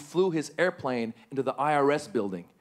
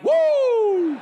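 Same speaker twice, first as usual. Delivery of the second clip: huge high whoop of relief; Woo cheer; 9 dB louder than usual. Retold or imitated. Comedian cheering the name on TV, huge relieved whoop. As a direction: whoop like the news saved you. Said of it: "I'm like".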